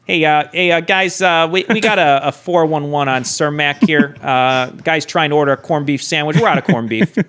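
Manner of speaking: put-on thick Chicago deli guy accent; high, hammed-up Chicago counterman. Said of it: "do, like, a heavy Chicago accent".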